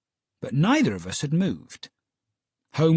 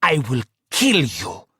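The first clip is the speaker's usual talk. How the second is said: threat; 3 dB louder than usual. Acted.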